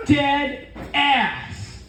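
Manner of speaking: "Dead ass" high, then falling away; emphatic confirmation. Retold or imitated. Zoomer chick confirms to her friend, "Dead ass" high then falling.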